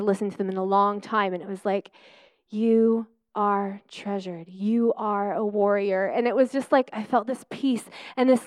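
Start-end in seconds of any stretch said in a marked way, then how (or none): 2.5-6.0 reassuring recording. Retold or imitated. Quoting her healing recording, slow gentle affirmation. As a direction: lightly voice the recording's calm reassurance. Recorded identity statements quoted.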